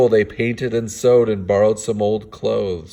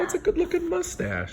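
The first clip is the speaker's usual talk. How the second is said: much higher silly voice; mock admiration; 7 dB quieter, faster than usual. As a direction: gush like a cartoon fan. Joking admiration of mustache.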